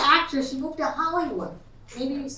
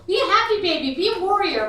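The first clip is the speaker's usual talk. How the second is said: mock spaced-out yoga teacher, higher and louder; high yoga instructor; 7 semitones higher, 8 dB louder than usual. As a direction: loudly chirp it like a zonked instructor. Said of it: "she was high on drugs".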